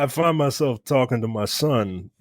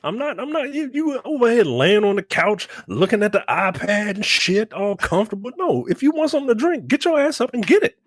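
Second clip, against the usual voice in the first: put-on raised, high-pitched scolding of a lazy kid; mock stern dad; 9 semitones higher than usual. Dad scolding four-year-old son on couch, high raised voice.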